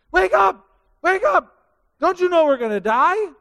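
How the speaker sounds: high, loud frantic yell; frantic shout. Acted. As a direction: shake him awake in panic. Disciples waking Jesus in storm.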